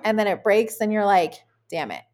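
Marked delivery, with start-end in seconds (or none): 1.7-2.0 much lower, much softer groan of regret; muttered regret. Retold or imitated. Imitating herself after the injury, muttered regret. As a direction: sink into defeat under your breath.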